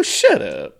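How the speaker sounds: ordinary talk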